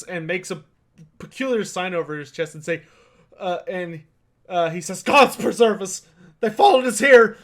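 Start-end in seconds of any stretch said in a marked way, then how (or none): 5.0-7.4 loud, high-pitched cry of dread; acted panic, loud and raised; alarmed peasant. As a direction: cry out in fright to your companions. Peasant recoils from the newcomer.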